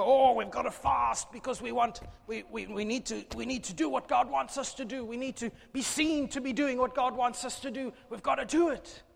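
high, loud whiny voice of the self-righteous fasters; mock pious congregation. Preacher mocking the fasting people, high and whiny. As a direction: ham up the smug worshippers.

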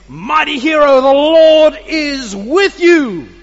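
loud, slow, commanding heavenly voice; slow booming angel greeting. Acted. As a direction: proclaim it like a mighty angel.

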